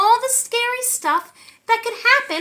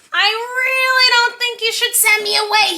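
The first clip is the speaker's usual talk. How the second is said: warning; 6 semitones higher than usual. Acted. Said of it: "he warned".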